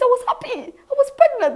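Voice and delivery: happy exclaim. Retold or imitated. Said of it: "He said".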